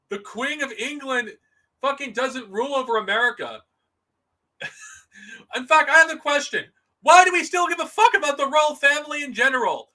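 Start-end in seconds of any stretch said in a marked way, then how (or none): 5.6-9.9 raised, louder heated rant. Real, not acted. Vlogger ranting at royal fans, loud and heated. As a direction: push it up, fired up and indignant.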